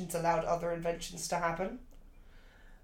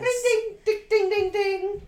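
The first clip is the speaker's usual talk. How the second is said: game show bell; 12 semitones higher, 5 dB louder, slower than usual. Pretend. Marks a right answer.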